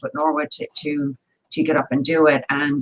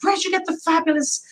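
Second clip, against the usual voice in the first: high-pitched gushing question; eager, much higher quoted voice; gushing admirers; 11 semitones higher than usual. Retold or imitated. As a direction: imitate fans gushing over an outfit.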